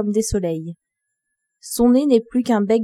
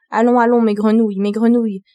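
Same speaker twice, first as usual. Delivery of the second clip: urgent summons, mildly raised voice; appel pressant; 4 dB louder than usual. A narrator's reading. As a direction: call out a little louder. King urgently calls his frogs. (appel pressant, voix un peu forte)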